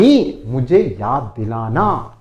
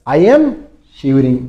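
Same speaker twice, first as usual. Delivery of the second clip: loud model phrase; 6 dB louder, much slower than usual. Shown as a demonstration. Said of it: "कांपना, shiver".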